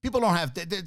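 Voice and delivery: normal talking voice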